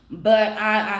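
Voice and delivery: ordinary talk, nothing marked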